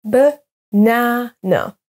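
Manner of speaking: "Banana" slowly as buh... NA... na; second-syllable stress. Shown as a demonstration. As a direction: split "Banana", strongest on NA in the middle. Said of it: "s'accentue sur la deuxième syllabe".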